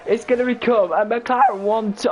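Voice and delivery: ordinary delivery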